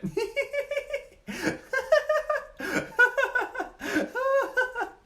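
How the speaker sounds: long, loud, very high laugh; full unrestrained high laughing fit; cranked-up laughter. Shown as a demonstration. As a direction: let the laugh build and roar. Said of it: "crank up the laughter a little bit".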